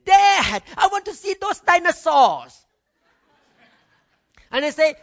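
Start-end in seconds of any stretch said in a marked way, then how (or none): 0.0-2.6 put-on much higher, loud, bursting-in child voice; a boy bursting in to ask his father about dinosaurs, high and loud; excited little boy. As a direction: call out to dad like an eager kid. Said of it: "he come to me in a casual way".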